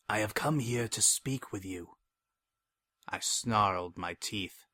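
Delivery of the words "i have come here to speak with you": softly sly, smooth-talking brother; lightly voiced cunning schemer. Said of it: "the lying worm's voice just as sly as ever".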